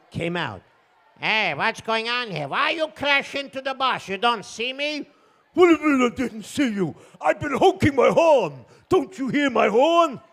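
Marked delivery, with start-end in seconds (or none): 1.2-5.1 high, put-out protest; accented bus driver. Acted. Bus driver to the man who hit him, indignant. 5.5-10.2 loud, high exasperated yell; angry driver's shout. Acted. Car driver to the bus driver after the crash, angry shouting.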